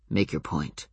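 low, quiet and curt; clipped low warning; low terse command. Acted. Adam tense, impatient.

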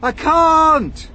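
high, loud helpless shout; desperate cry. A narrator's reading. James cannot save his friends, desperate cry. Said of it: "cried James".

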